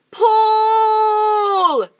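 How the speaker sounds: very loud, high drawn-out yell. Acted. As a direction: bellow pull. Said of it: "yelled Mugglewump".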